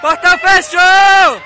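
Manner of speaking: shouting. Real, not acted. Announcing gate shut.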